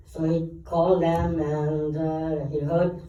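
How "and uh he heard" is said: ordinary talk, nothing marked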